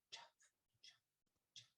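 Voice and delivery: tiny, very soft "chuff" puffs; soft chuffing footsteps. A narrator's reading. Reader imitating father's shoes for children, soft chuffing. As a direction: imitate shoes scuffing softly on a path. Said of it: "They make a soft sound".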